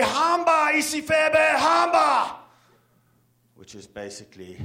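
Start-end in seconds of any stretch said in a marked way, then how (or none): 0.0-2.4 bellowed at full force, much higher and louder; a savage yell of hamba; furious shout. Retold or imitated. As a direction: roar the curse to scare off a lion. Shouting a lioness down in Zulu.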